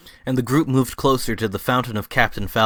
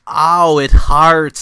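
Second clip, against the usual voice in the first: high, loud hammed-up yelp of pain; mock pain cry; 5 semitones higher, 10 dB louder, much slower than usual. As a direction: cry out as the nail goes in. Wiseau being nailed to cross.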